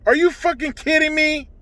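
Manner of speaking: can't believe what he's hearing; furious rhetorical question; outraged disbelief. Real, not acted. Outraged at abortion without consent.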